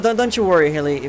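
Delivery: ordinary talk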